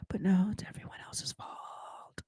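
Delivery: sarcastic breathy whisper, barely voiced; mock whisper. Host mocking the woman's blame-shifting in a whisper. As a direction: whisper it like a snide aside to the audience.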